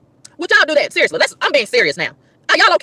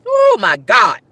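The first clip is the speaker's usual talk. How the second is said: ordinary talk, nothing marked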